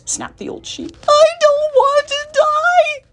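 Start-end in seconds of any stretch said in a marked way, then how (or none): 1.1-3.0 very high, loud shriek; drawn-out hysterical squeal; despairing scream. Acted. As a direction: scream it slowly in a full tantrum. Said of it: "screamed Wilbur".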